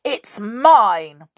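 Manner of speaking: loud, possessive outburst; storybook bellow of ownership; indignant shout. A narrator's reading. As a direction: shout it back, hitting MINE. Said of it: "shouted Moonface".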